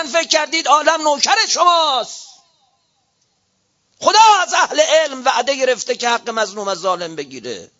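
high, loud pulpit harangue; fiery preaching. Real, not acted. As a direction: thunder at the clerics from the pulpit. Preaches at full force.

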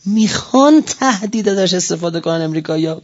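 ordinary talk, nothing marked

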